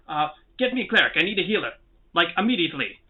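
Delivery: acted urgent command; urgent yell. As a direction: order a guard off in alarm. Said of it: "he yells out".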